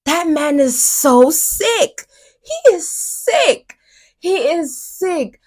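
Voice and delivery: high, slow disgusted outburst; appalled and sickened, drawn out. Real, not acted. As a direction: spit out the disgust slowly. Ranting about [name], rising disgust.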